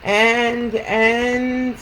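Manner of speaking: measured storytelling with lilting questions; picture book read-aloud. Acted. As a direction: read to children, pausing after each thought.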